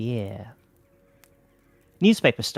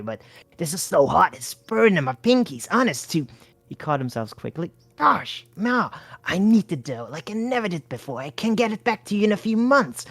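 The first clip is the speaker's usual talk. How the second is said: lightly voiced hustler; 8 semitones higher than usual. A narrator's reading. Bart pleading for money.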